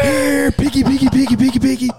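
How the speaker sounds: very high, loud hog-calling squeal; imitation hog caller at full squeal; screamed pig call. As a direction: shriek it out like a pig-calling contestant.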